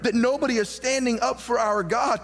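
lightly voiced earnest shepherd boy; indignant young David. David answering his brother, mild indignation. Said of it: "And David said".

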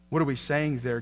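usual speaking voice

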